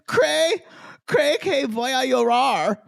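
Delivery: high, loud dramatic Spanish imitation; imitating her. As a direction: mimic her overwrought Spanish. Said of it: "when she's like".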